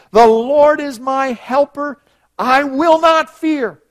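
loud, high, slow proclamation; bold, confident declaration. Acted. Preacher urging believers to say it boldly, confident and loud. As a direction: declare it without a trace of hesitation. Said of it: "So we may boldly, you could say confidently, without hesitation".

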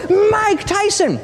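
dance and shout it; excited shout. Retold or imitated. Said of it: "both of us were shouting".

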